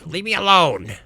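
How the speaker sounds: high, loud shout through a door; irritated boss. Acted. Skaven yells from behind door.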